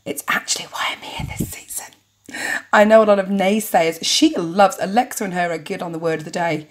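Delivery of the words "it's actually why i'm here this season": secretive whispered confession. Acted. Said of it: "you drop your voice to a whisper".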